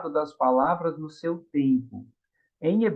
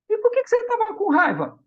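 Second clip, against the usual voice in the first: much higher, louder questioning voice; a teacher probing kindly, pitched way up; teacher asking; 3 dB louder, faster than usual. Retold or imitated. Teacher asking the boy why he pulled her hair.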